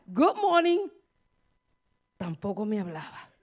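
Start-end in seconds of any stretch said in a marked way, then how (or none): 0.1-0.8 bright greeting. Retold or imitated.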